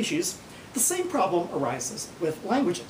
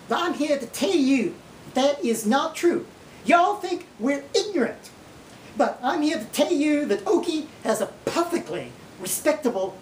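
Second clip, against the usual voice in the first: put-on Okie accent; 9 semitones higher than usual. Held Okie voice, Oklahoma teacher.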